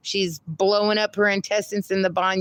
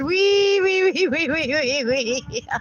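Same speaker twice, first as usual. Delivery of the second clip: very high squeals fading; high wees tapering off; squealing wee; 6 semitones higher than usual. Retold or imitated. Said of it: "Kyle said".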